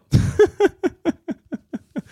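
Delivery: a long high laugh. Real, not acted. Comic laughing at the wife's threat, high laugh.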